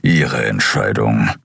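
deep, slow grumble; low gruff resignation; grumbled. A narrator's reading. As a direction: drop low and drawl it dismissively. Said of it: "brummte Keru".

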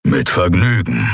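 lightly growled reply. A narrator's reading. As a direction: growl it with relish. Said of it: "knurrte Keru".

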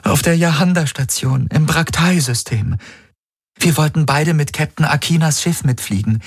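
high-pitched, earnest recounting; imploring. A narrator's reading. Woman recalling their first meeting for him, imploring. She pleads for him to remember.

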